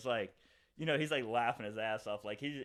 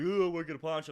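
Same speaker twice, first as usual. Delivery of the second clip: high, loud sneering imitation; exaggerated singled-out mockery, pitched up; mocking taunt; 5 semitones higher, 7 dB louder than usual. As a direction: jeer at him like a childish drunk. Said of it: "starts doing, like, the, the finger of the nose".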